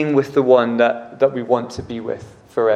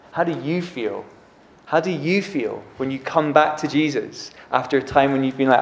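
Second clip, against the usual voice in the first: usual speaking voice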